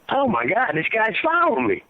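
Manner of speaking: suddenly it clicks: he's following me; a retold startled gasp of realization; alarmed realization.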